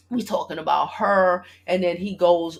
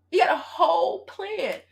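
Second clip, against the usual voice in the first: slow, higher, laughing repeat; laughing disbelief; 10 semitones higher than usual. Real, not acted. Telling viewers about the ex's big plan, laughing slowly. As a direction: laugh at how planned it is.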